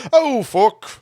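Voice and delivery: high, exaggerated British "fuck"; hammed-up fake British accent; bad British accent. Shown as a demonstration. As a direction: belt out a cartoon British curse. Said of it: "I just say, I just say".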